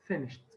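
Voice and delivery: "finished" with the ED said as a t, finisht; correct pronunciation. Shown as a demonstration. Teacher showing students the correct way, finisht with a final t. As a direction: end "finished" on a crisp t sound. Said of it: "This is how you pronounce the word correctly".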